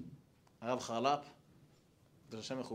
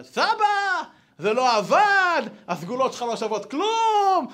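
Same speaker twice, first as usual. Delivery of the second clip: put-on upset boy yelling at grandpa, much higher and louder; child bursting in, shouting; 11 semitones higher, 9 dB louder, slower than usual. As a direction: burst in furious that it didn't work. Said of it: "מתפרץ הבא". (ילד פורץ בצעקה כועסת על סבא)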